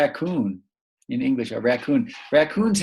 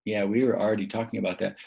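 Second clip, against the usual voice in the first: ordinary talk, nothing marked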